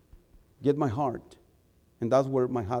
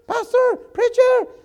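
much higher, calling out from the dropped phone; caller's voice on phone; 4 dB louder, slower than usual. Retold or imitated. Young man on the phone calling for the preacher, high-pitched. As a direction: call from the phone insistently, high. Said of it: "I heard his voice".

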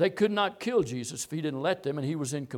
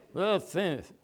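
imitating a beaten, spent mumble; mock weak groan, words slurred; feeble dying voice. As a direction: say it as if drooping and defeated. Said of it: "He's not down here saying".

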